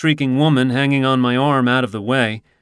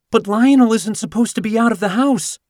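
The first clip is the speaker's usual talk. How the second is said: higher, anxious woman's voice protesting; 11 semitones higher, 3 dB louder than usual. Acted. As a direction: plead against it as the worried owner. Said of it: "she protests".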